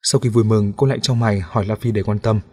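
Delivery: ordinary delivery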